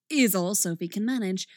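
ordinary delivery

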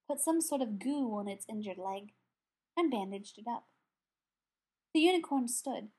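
ordinary delivery

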